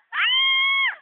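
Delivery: loud, high, sustained scream. Real, not acted. Friend reacting to a scary ride, high scream. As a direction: let out a long high shriek.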